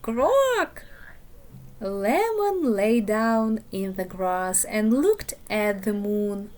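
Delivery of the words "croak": high, loud croak; group frog shout. Acted. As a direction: yell it all together. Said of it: "every frog shouted together".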